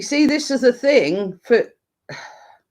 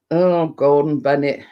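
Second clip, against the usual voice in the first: usual speaking voice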